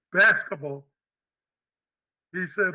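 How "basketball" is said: plain reading voice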